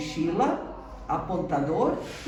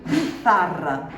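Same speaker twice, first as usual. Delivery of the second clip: slow model word; 4 dB louder, slower than usual. Shown as a demonstration. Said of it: "teacher models vocabulary".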